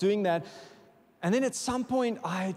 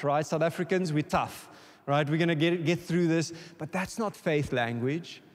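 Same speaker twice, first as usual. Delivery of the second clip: normal talking voice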